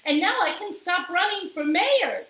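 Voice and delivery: high, bright-eyed character voice; Amelia Bedelia. A narrator's reading. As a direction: say it high and cheerful. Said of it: "said Amelia Bedelia".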